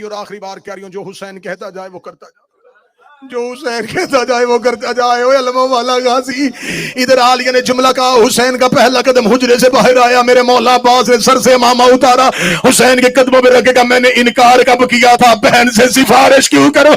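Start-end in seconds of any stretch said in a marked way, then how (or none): 3.3-5.2 loud repeat. Acted. Repeat raised to full force. 5.2-16.9 loud high majlis climax; preaching at full force. Acted. Closing full-force recital.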